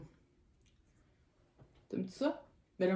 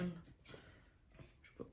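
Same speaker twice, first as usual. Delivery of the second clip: whispered; 3 semitones lower, 22 dB quieter, much slower than usual. Real, not acted. Trailing off.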